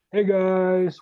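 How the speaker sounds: high, oblivious friendly hail; imitated wave-and-holler greeting; cheery calling out. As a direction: call over to the squad, mock-cheerful. Retold wounded man waving to squad.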